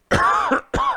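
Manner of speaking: breathy unvoiced choking cough. Real, not acted. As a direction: cough out a crumb. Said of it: "Excuse me".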